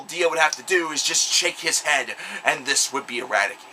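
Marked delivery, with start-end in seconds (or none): none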